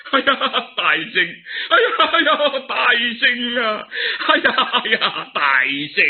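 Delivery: high cackling laugh calling; laughing loudly. Acted. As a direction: laugh out with joy. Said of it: "咔咔声大笑三声".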